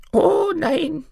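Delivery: very high crone voice; high-pitched little granny; old granny protesting. Acted. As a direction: deny it in a piping old woman voice. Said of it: "erwiderte das Mütterchen".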